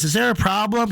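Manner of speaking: high-pitched mock yell; retold yell. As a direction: imitate her yelling a challenge. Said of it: "She's yelling".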